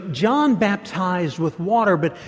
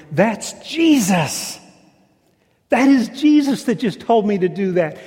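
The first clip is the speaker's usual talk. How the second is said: sudden realization; 4 semitones higher, 5 dB louder than usual. Acted. Peter realizes the man on shore is Jesus, dawning amazement. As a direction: let it hit him mid-word.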